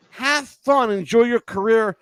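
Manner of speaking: sneering good luck; sarcastic send-off. Acted. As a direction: wave them off with scorn. Mocking send-off to reckless wrestlers.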